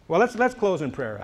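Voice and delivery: ordinary talk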